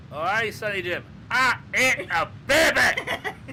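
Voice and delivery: loud, slow, laughing Scottish brogue; Scottish accent. Acted. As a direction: boom it out in a thick Scottish accent. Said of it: "I'm actually Scottish, so I can actually do that".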